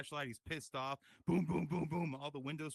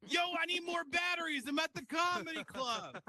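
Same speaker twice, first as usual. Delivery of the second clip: much higher, louder mock yell across a crowd; yelling audience member; 13 semitones higher, 4 dB louder than usual. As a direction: shout it across the room, half-hammed. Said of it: "audience members yelling".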